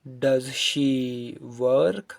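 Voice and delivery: very slow, drawn-out example question; deliberate teacher's drill delivery; model question. Shown as a demonstration. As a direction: draw out each word for learners.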